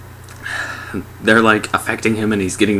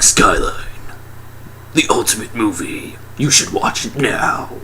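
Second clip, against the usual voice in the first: mock dramatic trailer announcer; movie trailer voice. Trailer announcer selling Skyline, mock-epic hype. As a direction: draw it out like an overblown film promo. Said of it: "in the trailers they were like".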